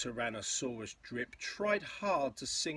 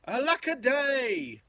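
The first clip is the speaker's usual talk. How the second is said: higher, drawn-out lament; theatrical cry; 12 semitones higher, 8 dB louder, slower than usual. A narrator's reading. Left-behind Drip laments his lot, theatrical cry. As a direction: wail it in mock woe. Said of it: "And he cried".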